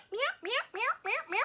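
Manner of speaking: high, soft little meows mimicking the cat; imitated cat meows. Imitating her cat waiting at the window, soft high meows. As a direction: mew like a small cat pacing the window. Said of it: "and he meow".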